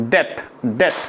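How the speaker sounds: debt said as "det" with no b; debt pronounced "det" for the class; silent b in debt. Shown as a demonstration. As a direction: say debt like "det", dropping the b completely. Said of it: "बी का pronunciation नहीं होगा बेटा".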